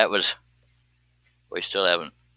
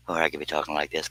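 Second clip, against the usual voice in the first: a light hint of soft, quieter Bob Ross; softer, like a calm painting show host; 4 semitones lower, 7 dB quieter, faster than usual. Shown as a demonstration. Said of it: "kinda like Bob Ross".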